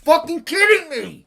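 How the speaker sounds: sudden high, loud yell of rage; real angry outburst; furious shout. Real, not acted. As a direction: explode at the screen. Outburst at a losing trade.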